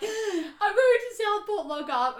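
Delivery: high, loud, slow sobbing wail; retold crying. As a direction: wail it like a panicked, crying girl. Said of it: "I am crying my eyes out".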